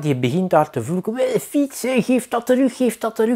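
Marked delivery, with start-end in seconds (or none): 1.1-3.3 mock angry cyclist. Cyclist demanding bike back.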